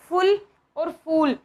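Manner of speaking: फुल short, then फूल with a long ऊ, slowly; slow contrast. Shown as a demonstration. Teacher contrasting short and long u slowly. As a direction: set फुल against a stretched फूल. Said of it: "आप खुद देख के देखिए".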